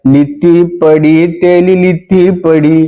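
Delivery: high, slow lisping singsong; lisping girl mocking the soldier in song. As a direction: sing it with a childish lisp. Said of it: "तोतली ने फिर फौजी के ने इशारा करया".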